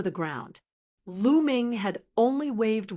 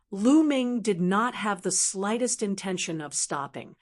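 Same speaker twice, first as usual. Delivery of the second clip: ordinary delivery, nothing marked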